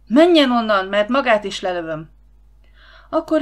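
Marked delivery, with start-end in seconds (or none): 0.1-2.1 loud, harsh threat; rough shout. A narrator's reading. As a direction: bark it as a threat. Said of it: "durván kiáltotta".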